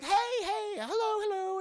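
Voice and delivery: high put-on girlfriend hello hello; Lizzie's voice. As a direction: play Lizzie on the phone. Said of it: "Lizzie's like".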